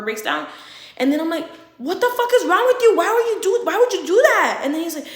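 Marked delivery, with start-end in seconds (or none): 1.8-4.6 high, loud retold confrontation; imitated outraged demand; acted angry yelling. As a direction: lay into the driver after a near miss. Acted angry confrontation after near crash.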